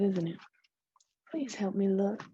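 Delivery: ordinary delivery, nothing marked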